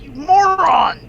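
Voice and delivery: high, loud mock scolding; mock exasperated yell. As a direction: yell it at a hopeless idiot, hammed-up. Mock scolding of an idiot character.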